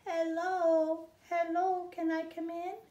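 high, small birdlike voice; a tiny high chirping baby bird; little bird voice. Acted.

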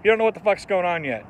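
normal talking voice

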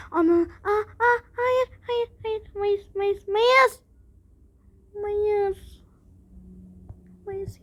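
high, urgent no no no; panicked realization. Real, not acted. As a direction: scramble to fix the answer. Sudden realization, panicked correction. (panikle fark ederek)

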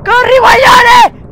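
high, slow, loud cry; panicked yell. Real, not acted. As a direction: shout at yourself to run. Inseguita, grida. (urlo disperato in napoletano)

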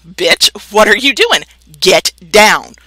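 ordinary delivery

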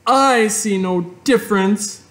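put-on stubborn girl; Fern voice. A narrator's reading. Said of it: "replied Fern".

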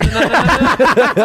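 slow, laughing protest; laughing denial. Real, not acted. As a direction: laugh through the no's.

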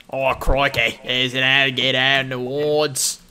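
loud hammed-up Australian outback voice; Australian accent shouted for fun; mock Australian accent. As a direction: play a brash Australian wildlife wrangler. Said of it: "I'm on point with the accents tonight".

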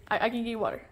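ordinary talk, nothing marked

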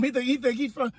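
ordinary talk

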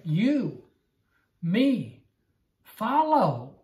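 each word You, me, follow hit separately; emphatic. Shown as a demonstration. Preacher stressing You, me, follow to the congregation. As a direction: punch You, me, follow one by one. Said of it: "The Greek is emphatic".

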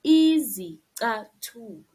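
Izicathulo with a Z at the start; pronunciation demo. Shown as a demonstration. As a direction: say Izicathulo clearly, falling. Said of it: "we say izicathulo".

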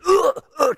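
high, strained mock groans, as if crushed; squashed kids groaning. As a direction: imitate little kids being squashed under you. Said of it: "kinda like I was killing 'em".

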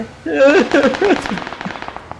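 long, high-pitched laugh. Real, not acted. Gamer laughing as a car approaches, high laugh. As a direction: let out a real excited laugh, very high.

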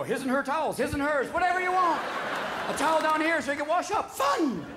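high, loud gushing excitement; mock bubbly enthusiasm; gushing pierced girl. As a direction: sell your nipple hoops like a delighted hostess. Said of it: "you go".

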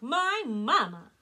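loud, high, slow bellow. A narrator's reading. Elmer to Drake, loud bellow. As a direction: shout it back proudly. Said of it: "Elmer bellowed back".

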